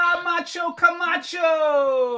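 high, slow ring-announcer call of the name; announcer greeting. Pretend. Host welcoming the guest, slow high announcer call. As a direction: stretch Hola, Macho Camacho out like introducing a fighter.

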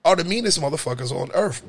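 ordinary talk

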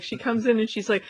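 ordinary delivery

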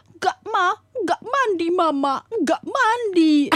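high, drawn-out mock-crying toddler; imitated whining child. Child pleading with mama about bath, whiny mock cry. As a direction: whine like a kid dodging bath time. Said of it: "dia teriak-teriak".

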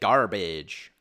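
drawn-out mock American "garbage"; mock American accent. Brit mimicking how Americans say it, mock American accent. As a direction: stretch it out like an American. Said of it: "You guys say garbage".

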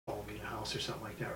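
fast whispered worry; hushed worry. Retold or imitated. Woman retells her fear of being followed, fast and whispered. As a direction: rush it under your breath.

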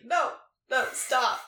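hammed-up shutdown of a partner; mock protest. Imitating herself turning down a foreign partner, mock protest. Acted refusal of the Chinese pillow talk.

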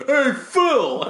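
shouted greeting from afar; calling out. Retold or imitated. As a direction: call out to a stranger. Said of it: "then he hears a".

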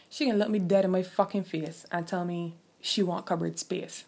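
ordinary talk

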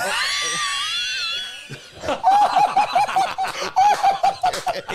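wild shrieking laughter; loud high laugh. Real, not acted. Friends cracking up at the crying dad story.

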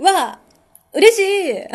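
high, loud delighted squeal; thrilled. Real, not acted. Squealing with joy to thank a fan whose friend became a fan. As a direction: squeal with real joy at the news.

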